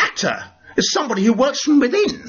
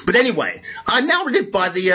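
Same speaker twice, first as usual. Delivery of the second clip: usual speaking voice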